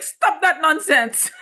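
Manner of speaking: mock-stern "stop that nonsense", high and slow; stern mom retort. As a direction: play the no-nonsense mother. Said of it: "I'm like".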